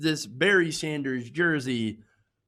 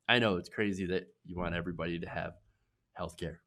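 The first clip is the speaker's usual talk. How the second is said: ordinary talk, nothing marked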